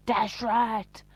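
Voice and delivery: punchy imitation of someone shouting out; mock call-out.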